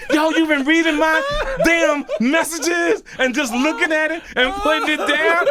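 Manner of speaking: loud, very high comic outrage; hammed-up exasperation, high and loud; mock fury. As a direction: rant at him, half laughing. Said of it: "I wanna stab him".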